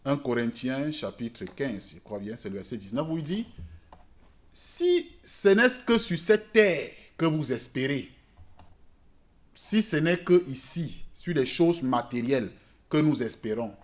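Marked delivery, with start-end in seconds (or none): none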